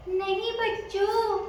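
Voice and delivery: high, slow, coaxing wolf as mummy; thin the voice and draw it out; thinned-out voice. A narrator's reading. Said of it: "अपनी आवाज पतली कर दी". (पतली, खिंची हुई आवाज)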